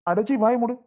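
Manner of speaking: furious order to shut up; angry shout. Acted. Husband yelling at his pleading wife to shut up. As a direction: snap it out loud and high.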